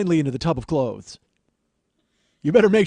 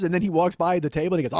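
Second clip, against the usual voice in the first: normal talking voice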